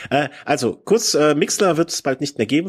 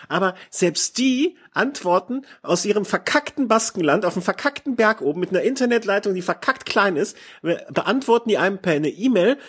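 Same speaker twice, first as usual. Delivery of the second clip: sarcastic rant; 7 semitones higher than usual. Real, not acted. Sarcastic rant about Basque race.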